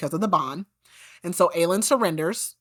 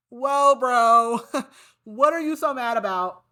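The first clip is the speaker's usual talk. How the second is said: mock scolding bro; 6 semitones higher, slower than usual. Narrator telling Aedion off for calling Lysandra names, jokingly. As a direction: teasingly rein him in. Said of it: "and I'm like".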